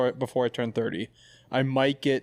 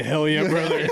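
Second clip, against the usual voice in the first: laughing mock bro catchphrase, louder; 3 semitones higher, 4 dB louder than usual. Mocking what dudes say, laughing hammed-up bro voice. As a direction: ham up the dude slang through a laugh. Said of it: "Dudes hear that and they say".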